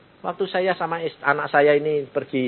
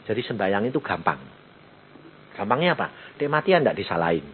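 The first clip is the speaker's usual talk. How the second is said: normal talking voice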